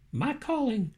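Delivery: plain reading voice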